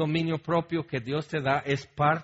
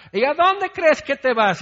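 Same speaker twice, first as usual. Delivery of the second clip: higher, louder, demanding retort; angry challenge; 12 semitones higher, 9 dB louder than usual. Retold or imitated. Spouse demanding the other stop climbing the stairs, angry. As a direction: call out sharply after the one leaving. Quarrel, chasing spouse upstairs.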